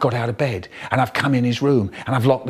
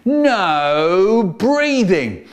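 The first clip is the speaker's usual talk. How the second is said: very slow, stretched-out command; drawn-out teacher's order, swooping high; strict teacher; 5 semitones higher, 4 dB louder, much slower than usual. Acted. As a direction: draw each word out sternly.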